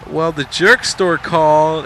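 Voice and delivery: loud, slow comeback delivery; George. Retold or imitated. George firing back his comeback, loud and drawn out. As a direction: lean hard into the comeback. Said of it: "and then he comes back with".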